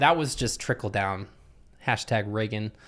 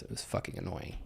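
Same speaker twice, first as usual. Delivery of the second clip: whispered aside; 5 semitones lower, 14 dB quieter, faster than usual. Real, not acted. Annoyed aside.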